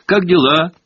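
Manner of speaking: high, forced bright greeting; fake cheerful. A narrator's reading. Hermione greeting sulky Myrtle with forced cheer. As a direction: put on a falsely cheerful tone. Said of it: "делано весёлым тоном".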